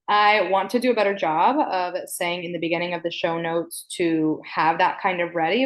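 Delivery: usual speaking voice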